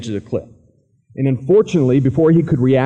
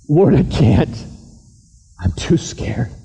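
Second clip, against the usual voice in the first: crying; slower than usual. Acted. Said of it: "Will started crying".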